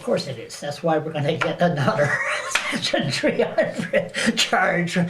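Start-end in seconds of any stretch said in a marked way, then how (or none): 1.6-5.0 talking through laughter; laughing. Real, not acted. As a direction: let the joke break into laughter. Joking about getting another charge.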